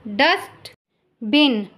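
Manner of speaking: slow, stretched dustbin; slow careful model word. Shown as a demonstration. Teacher modelling dustbin slowly for listening pupils. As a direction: draw out dustbin for the class. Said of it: "listen to your teacher say the following words".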